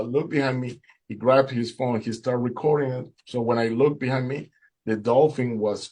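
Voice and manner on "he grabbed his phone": ordinary delivery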